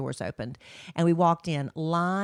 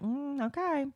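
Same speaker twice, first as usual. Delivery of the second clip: skeptical okay; 9 semitones higher, 5 dB quieter than usual. Retold or imitated. Mom reacting to allowance request.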